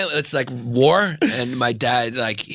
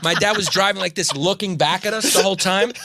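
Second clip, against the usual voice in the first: ordinary delivery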